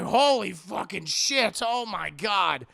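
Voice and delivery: loud, slow, drawn-out disbelief; mock shock. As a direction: stretch each word in stunned shock. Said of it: "He can't believe it".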